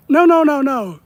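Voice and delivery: high, loud run of no's; loud, high protest at the chat. Real, not acted. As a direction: shut the idea down fast and loud. Protesting a viewer's idea.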